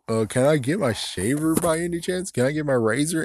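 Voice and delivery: lightly voiced snotty teen asking for the razor; teen attitude. [name] to his dad, asking for the shaver back with a mild mock teen attitude. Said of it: "the attitude came out of nowhere".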